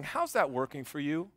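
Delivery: God drawing near and asking softly; still small voice. Retold or imitated. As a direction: hush it down, tender and close. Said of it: "kind of in his still small voice".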